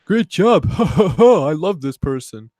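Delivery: mock cheering. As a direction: parody smug applause. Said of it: "They're like".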